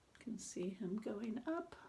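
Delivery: hushed whispered aside. Real, not acted. Reader pointing at the picture for children, whispering.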